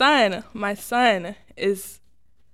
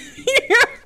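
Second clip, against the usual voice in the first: embarrassed laugh; 7 dB louder than usual. Real, not acted. Guest laughs at own confusion.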